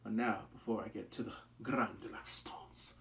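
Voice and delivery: whispered; whispered aside. Real, not acted. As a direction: lean in and whisper to the audience. Aside to viewers.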